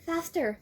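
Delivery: high voice through barely parted lips; pinched, tight-lipped girl; tight-lipped murmur. Acted. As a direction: speak with lips held tight over the teeth. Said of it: "lip close to mouth".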